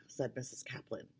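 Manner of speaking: plain reading voice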